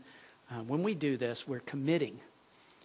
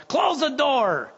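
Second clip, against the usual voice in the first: higher, louder slow yell; 9 semitones higher, 5 dB louder, slower than usual. Retold or imitated. Stranded diner guest yelling at the open door, loud. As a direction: shout at whoever let the cold in. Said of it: "somebody yelled".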